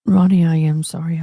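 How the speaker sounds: normal talking voice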